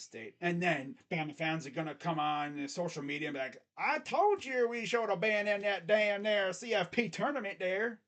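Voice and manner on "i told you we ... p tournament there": put-on Alabama accent. Host mimics Bama fans gloating on social media, hammed-up Alabama accent. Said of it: "I can't do my freaking Alabama accent".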